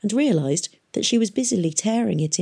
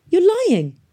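high, louder outburst; upset accusation; 14 semitones higher, 6 dB louder than usual. A narrator's reading. Boy refuses to believe girl about Valentine, upset. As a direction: throw the denial back, high and loud. Boy repeats his tearful denial.

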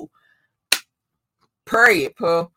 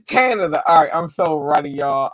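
ordinary talk, nothing marked

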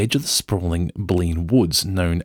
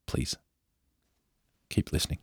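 ordinary talk, nothing marked

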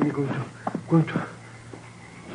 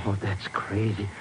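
scared whisper in the dark; 6 semitones lower, 7 dB quieter than usual. Acted. Sidekick whispering to Mister X near the well, hushed. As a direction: keep it under your breath so nobody hears.

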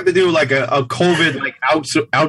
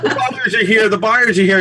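panicky; 6 semitones higher than usual. Retold or imitated. Panicked seller voiced in scene.

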